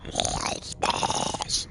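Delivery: harsh voiceless monster rasp; monsters calling out. Acted. Player imitating monsters he claims to hear, raspy whisper. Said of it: "They're saying".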